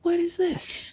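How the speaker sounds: a puzzled what-is-this; husband baffled by the apology talk; mock bewildered husband. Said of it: "And he was like".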